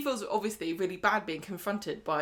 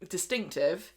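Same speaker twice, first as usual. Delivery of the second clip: ordinary talk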